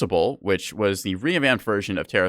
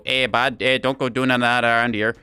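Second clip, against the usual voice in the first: a hammed-up Canadian accent, a bit louder; 2 semitones higher, 3 dB louder than usual. Shown as a demonstration. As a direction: play up a folksy Canadian accent, eh and all. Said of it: "toggle on Canadian mode for me to really go".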